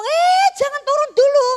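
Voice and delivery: very loud, high warning shout; acted urgent call, stretched opening cry; imitated alarmed doctor. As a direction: yell to stop the patient. Doctor stopping a new mother.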